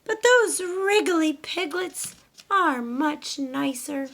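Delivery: high, slow hen voice, a touch deflated; a little hen trying to stay proud; high, slow, slightly sad hen voice; Dora the hen, wistful. Acted. Dora's thoughts.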